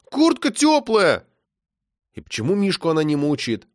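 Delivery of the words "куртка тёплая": put-on high, slow childish whine; boy whining that his jacket is warm. A narrator's reading. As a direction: drag out the complaint. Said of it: "заныл Серёжа".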